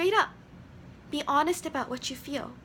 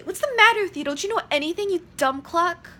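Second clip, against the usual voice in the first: loud scolding father, higher and louder; blamer father; 5 semitones higher, 8 dB louder than usual. Shown as a demonstration. Blaming father yelling at his son, loud scolding. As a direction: yell it down at the son. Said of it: "If I was a blamer".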